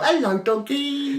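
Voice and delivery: very high, drawn-out pet greeting; imitating a doting dog owner; sweet dog-talk. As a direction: coo at the dog in a silly high voice. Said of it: "In the car".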